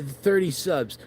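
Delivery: usual speaking voice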